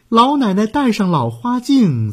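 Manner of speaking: usual speaking voice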